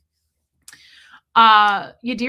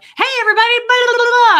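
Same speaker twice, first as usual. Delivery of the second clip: much higher, booming hey everybody; loud greeting; 13 dB louder, much faster than usual. Retold or imitated. Mocking her own loud welcome to shy viewers, loud and high. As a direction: blast it out like an over-eager host. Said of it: "I'm, like, really loud".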